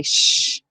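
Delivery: a whispered "sh-" hiss; voiceless "sh-", just the hiss; sh sound. Shown as a demonstration. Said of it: "T I to say".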